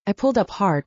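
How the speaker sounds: usual speaking voice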